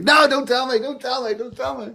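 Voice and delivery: high, frantic no-spoilers plea, fading; panicked plea. Retold or imitated. Friends begging not to know.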